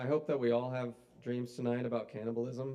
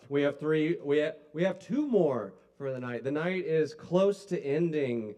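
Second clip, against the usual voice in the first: loud emcee announcement; rallying the crowd; 3 semitones higher, 10 dB louder than usual. Real, not acted. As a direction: project big and loud to the whole room. Host rallying crowd for last acts.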